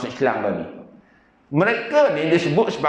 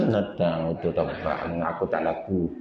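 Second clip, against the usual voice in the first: low, soft muttered aside; hushed, low-pitched comeback; quiet retort; 11 semitones lower, 7 dB quieter than usual. Retold or imitated. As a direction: grumble it softly to yourself. Muttered retort to aunt's taunt.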